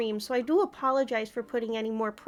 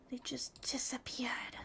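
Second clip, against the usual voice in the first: whisper; 15 dB quieter than usual. Real, not acted. Frustrated aside under her breath.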